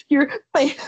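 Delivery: high, broken mock sob; hammed-up tearful squeak, slow and gasping; choked-up fan. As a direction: force it out haltingly through tears. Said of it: "The most I could get out".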